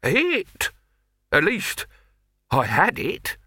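high, slow, dazed rambling; befuddled alchemist after a blast; perplexed, nearly concussed. Acted. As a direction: wander through it like someone still seeing stars. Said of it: "in the perplexed tones of the nearly concussed".

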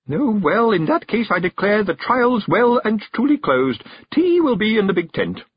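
high, loud hostess announcement; giddy relief. A narrator's reading. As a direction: proclaim the closing, almost drunk with relief. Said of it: "almost drunk with relief".

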